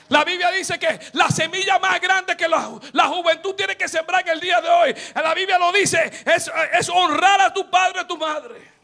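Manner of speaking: loud, sing-song preacher's exhortation; fervent preaching. Acted. Preacher urging youth to honour their parents, fervent loud preaching. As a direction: drive it home to the young people.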